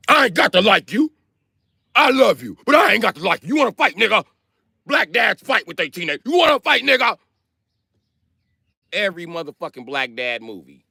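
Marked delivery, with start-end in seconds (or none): 0.1-7.2 hammed-up sitcom dad ready to fight his teenage son. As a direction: square up like a TV dad picking a fight.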